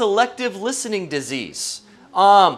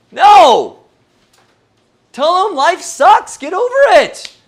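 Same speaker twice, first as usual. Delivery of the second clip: loud, higher hammed-up brush-off; mock dismissive shout; 6 semitones higher, 8 dB louder than usual. A joking Jesus brushes off the leaders, loud mock dismissal. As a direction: bark it off for laughs.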